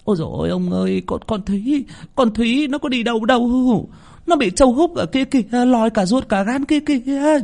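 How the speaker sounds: high, wailing, horrified stutter; stammering in terror. Acted. Mistress pointing at the shed, stammering in horror. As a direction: wail it out, stumbling on the name. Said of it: "miệng lắp bắp".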